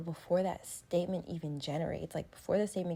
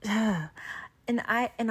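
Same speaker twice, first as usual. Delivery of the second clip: louder, exasperated venting with a sigh; real frustration, louder and pushing; frustrated; 5 semitones higher, 6 dB louder, slower than usual. Real, not acted. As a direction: let the frustration spill out. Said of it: "sounding so frustrated".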